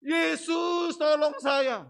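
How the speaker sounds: imitating father crying out to Jesus; shouted plea. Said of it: "menyebut nama Yesus".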